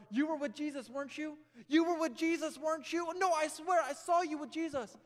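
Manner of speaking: loud, high accusing voices; accusing bystanders. Acted. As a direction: hurl the accusation at Peter. Accusers confront Peter.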